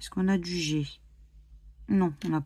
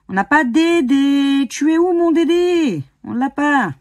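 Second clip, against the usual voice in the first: mock plaintive call; 6 semitones higher, 8 dB louder than usual. Scratching a losing ticket, she calls out for her Dédé in a mock plaintive voice. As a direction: cry out for Dédé like a lost pet. Playful pun calling for Dédé.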